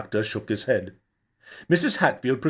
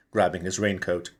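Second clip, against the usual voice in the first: ordinary delivery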